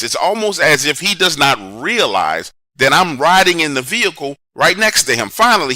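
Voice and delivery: ordinary delivery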